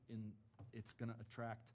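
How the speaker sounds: normal talking voice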